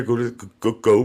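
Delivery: normal talking voice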